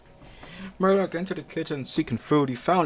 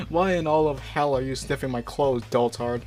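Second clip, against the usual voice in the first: normal talking voice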